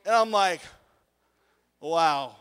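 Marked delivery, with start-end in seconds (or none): 1.8-2.4 low, deflated reaction; stunned. Retold or imitated. Pupil just mocked by his teacher, low and stunned. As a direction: retell being dumbstruck, pitched down.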